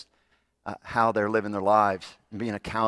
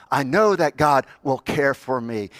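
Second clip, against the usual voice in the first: louder emphatic affirmation; 5 semitones higher, 8 dB louder than usual. Acted. Preacher repeats affirmation, rising.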